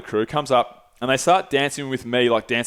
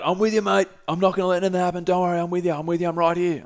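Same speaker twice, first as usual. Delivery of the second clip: higher reassuring mate; 5 semitones higher, faster than usual. Retold or imitated. Roisey reassuring him.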